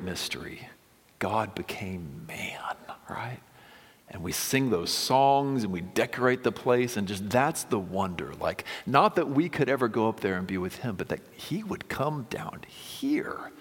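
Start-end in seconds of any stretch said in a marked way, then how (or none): 1.2-3.4 awed whisper. Real, not acted. Preacher whispering the mystery to the congregation. Sermon climax, marveling at mystery. 11.0-13.5 slow whispered wonder; awed whisper. Real, not acted. Preacher whispering wonder at God coming down.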